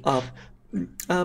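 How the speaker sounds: usual speaking voice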